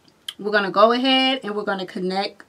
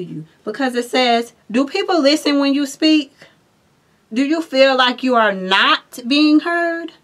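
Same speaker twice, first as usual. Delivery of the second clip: louder, higher, fired-up delivery; excited; 5 semitones higher, 7 dB louder than usual. Real, not acted. Reader excitedly telling Aries viewers their message, louder. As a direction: drive the message home with energy. Excited reading continues loudly.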